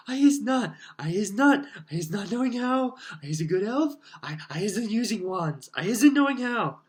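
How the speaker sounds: very high, loud squeaky elf voice, pleading; an elf begging, eyes rolling in terror; terrified house-elf. Acted.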